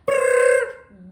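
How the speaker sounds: high, loud cartoon "Brrr" of legs taking off; running sound effect. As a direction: mimic feet spinning away, loud.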